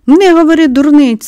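loud, high angry outburst; girl angrily shouting down the sick boy. A narrator's reading. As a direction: shout it at him in anger. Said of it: "сердито вигукнула Мері".

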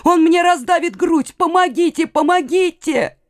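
high, desperate cry for help. A narrator's reading. Youth being crushed begs for help, crying out high. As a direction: call out high, begging for rescue. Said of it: "кричал юноша".

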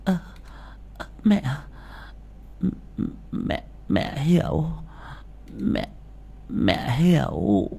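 put-on a timid old woman stumbling over mẹ hiểu; stammering. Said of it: "lắp bắp".

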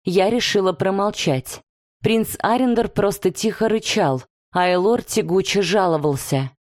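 ordinary delivery

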